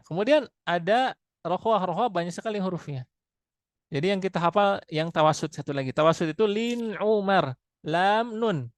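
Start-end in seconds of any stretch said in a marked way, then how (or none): none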